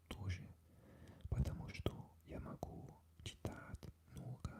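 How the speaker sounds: soft whispered Russian. Real, not acted. Telling viewers about practising Russian, whispered. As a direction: drop to an ASMR whisper.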